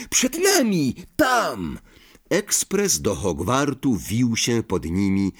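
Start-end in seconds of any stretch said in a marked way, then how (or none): 0.1-1.8 very high-pitched, contained shout; excited cry. A narrator's reading. Harry points out the train to Ron, excited high cry. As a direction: call out with pitch leaping up. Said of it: "krzyknął Harry".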